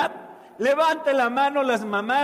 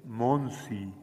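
low, sly tempting devil voice; 8 semitones lower than usual. Acted. Devil tempting Monsi, low and sly. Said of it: "Y te dice".

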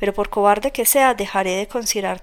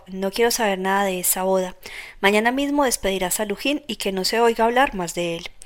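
ordinary delivery

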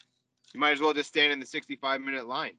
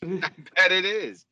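laughing; 5 semitones higher, 8 dB louder than usual. Real, not acted. Laughs at long line.

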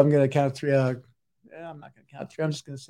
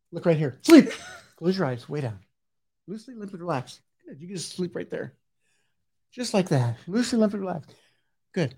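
hypnotic induction; 3 dB quieter than usual. Acted. Hypnotist to volunteer on stage, soothing sleep commands. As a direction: lull her down into trance.

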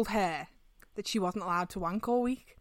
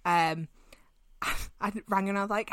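ordinary talk, nothing marked

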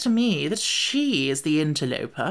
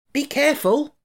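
a much higher, louder warning cry; boy crying out in alarm, pitched up; urgent cry; 12 semitones higher, 5 dB louder than usual. Acted. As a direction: stop him before he spills it. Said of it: "cried the boy".

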